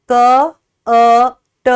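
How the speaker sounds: क, अ, ट as three separate short sounds, pauses between; sounded out letters. Shown as a demonstration. Teacher breaking cat into letter sounds, क, अ, ट sounded out separately. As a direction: sound out क... अ... ट one by one. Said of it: "alphabets को पढ़ रही हूँ".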